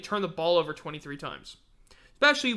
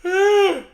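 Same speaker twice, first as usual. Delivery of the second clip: heavy exasperated sigh; 12 semitones higher, 11 dB louder than usual. Real, not acted. Exasperated after Clemson loss.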